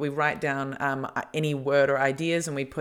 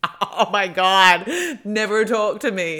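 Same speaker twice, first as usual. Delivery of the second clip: mocking quote; 5 semitones higher, 8 dB louder, much slower than usual. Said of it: "It's like".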